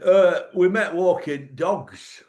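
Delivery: lightly voiced older northern man; mock slow northern couple. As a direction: stretch each word like a dull northern pensioner. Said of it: "They said".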